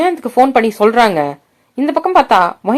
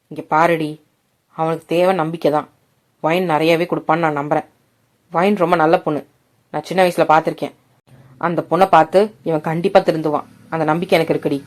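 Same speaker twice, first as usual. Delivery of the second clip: lower, softer fatherly voice; father's voice; 6 semitones lower, 5 dB quieter than usual. A narrator's reading. Father calming his anxious wife, low and soft. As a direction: reassure her gently as the father.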